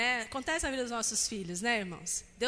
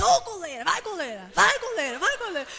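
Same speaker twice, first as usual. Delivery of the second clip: high, loud yelling at the goalkeeper; fan yelling; 6 semitones higher, 7 dB louder than usual. Retold or imitated. Grandfather yelling at the weak goalkeeper, loud and high. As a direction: shout encouragement from the sidelines. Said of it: "Aí meu pai assim".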